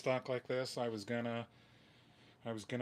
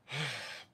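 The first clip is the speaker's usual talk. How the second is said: short weary exhale; defeated sigh. Real, not acted. Upset on a livestream about the guitar, defeated sigh. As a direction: sigh before giving in. Upset, trying not to curse.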